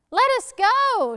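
high loud protest; shouting. Acted. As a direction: yell to be let go. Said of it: "shouted Legs".

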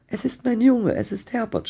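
high-pitched, desperate cry of the mother. A narrator's reading. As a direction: cry out high, holding back the volume. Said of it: "schrie sie".

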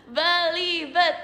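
"Belibet" stretched slowly, syllable by syllable; slow deliberate take. Shown as a demonstration. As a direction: sound out "Belibet" at half speed. Retrying the word she stumbled on. ("Belibet" dieja pelan per suku kata)

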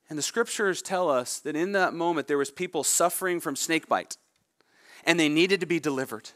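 ordinary talk

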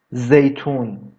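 ordinary talk

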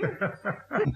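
short high-pitched giggle; a quick amused titter. Acted. Narrator giggling at the kobold's antics, high and light.

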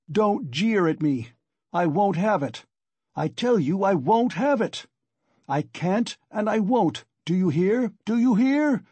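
acted tirade climbing in pitch; angry shout. A narrator's reading. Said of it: "he shouted".

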